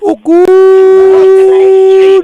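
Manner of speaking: high squeaky falsetto "Oh, good."; mock falsetto. Streamer squeals at the radio show in falsetto.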